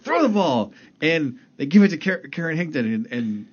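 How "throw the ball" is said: high, loud mock shout, insisting; impatient fan yelling. Fan in the stands demanding a pass, high mock shout. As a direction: yell it again like a frustrated fan.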